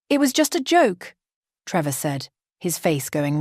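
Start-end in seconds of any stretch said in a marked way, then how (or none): 0.1-1.2 higher defensive man's protest; defensive groom. Acted. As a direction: brush it off, defensive.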